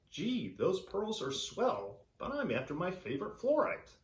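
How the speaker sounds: a breezy adventurer brushing off the worry; cheery old-fashioned explorer. Acted. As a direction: diver cheerfully answering the mermaids, high and jaunty. Diver answers the mermaids.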